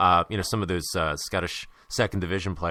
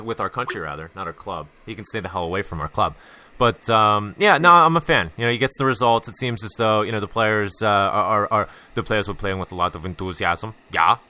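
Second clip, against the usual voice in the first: ordinary delivery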